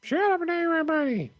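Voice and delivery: very high, loud cartoon impression; Fry. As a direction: squeal it out like an excited cartoon character. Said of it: "that was an amazing Fry impression".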